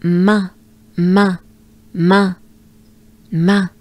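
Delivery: ma, nasal and loud, open mouth; French nasal ma. Shown as a demonstration. Tutor drilling the nasal ma, loud and exaggerated. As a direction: open wide and push the nasal ma. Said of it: "part of".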